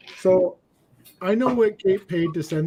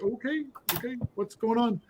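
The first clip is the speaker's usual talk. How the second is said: soft, higher-pitched retold bewilderment; hesitant, placating and hushed; wary confusion; 5 semitones higher, 7 dB quieter than usual. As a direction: act out backing off, small and puzzled. Acts out his bewildered reply.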